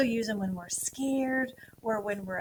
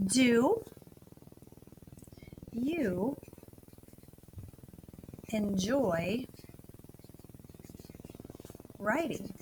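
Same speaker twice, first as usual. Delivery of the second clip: slow dictation; 6 dB quieter, much slower than usual. Shown as a demonstration. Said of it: "I just wanna ask you guys this question".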